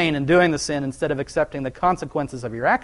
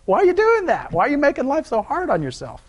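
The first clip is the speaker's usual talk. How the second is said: much higher, teasing voice of God; playful God voice; 10 semitones higher than usual. Retold or imitated. God teasing Paul for making life hard, high playful voice. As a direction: play God gently poking fun. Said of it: "He's like".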